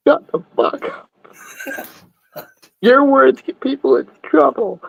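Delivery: high, hammed-up scolding voice; mock scolding. Friend cuts off the story in a mock squeaky scold. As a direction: shush him in a silly squeaky voice.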